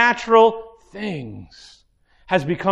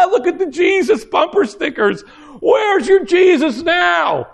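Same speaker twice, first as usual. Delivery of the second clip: high-pitched jeer through put-on laughter; mocking laughter; 10 semitones higher than usual. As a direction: cackle and taunt the stranded believer. Passing drivers jeer at stranded believer.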